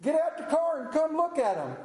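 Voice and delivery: a hammed-up cook's holler; retold holler. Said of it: "hollered at me from the cookers".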